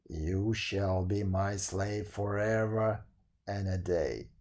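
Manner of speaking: slow, low lumbering giant voice; dim giant cyclops. A narrator's reading. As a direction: growl it out like a slow-witted giant. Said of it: "said the giant".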